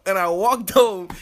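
ordinary talk

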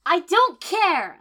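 very high, loud, slow snap; snapped. A narrator's reading. The heroine to Kiba, high loud snap. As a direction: cut them off sharply. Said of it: "I snapped".

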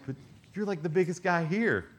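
high, incredulous little-guy voice. Retold or imitated. Little guy to the biggest guy there, high incredulous voice. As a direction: pitch it up like a small man in disbelief. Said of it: "this little guy's like".

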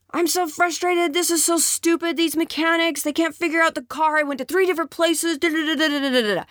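high, whiny venting husband; frustrated husband. Acted. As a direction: complain about the mechanics in a high voice. Husband venting after mechanics.

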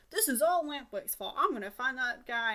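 indignant rant. Retold or imitated. Pinocchio blaming Lampwick.

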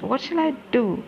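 high, breathless despair; frightened cry for help; panicked. Acted. Panic after finding the bodies.

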